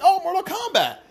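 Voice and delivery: high, eager gush over a new buy; hammed-up impulse buyer, high and excited; excited fanboy voice. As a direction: squeal it like a kid spotting a toy. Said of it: "But I was like".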